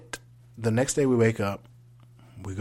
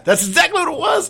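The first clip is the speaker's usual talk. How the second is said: emphatic squeak; 10 dB louder than usual. Real, not acted. Agreeing emphatically, squeaky high.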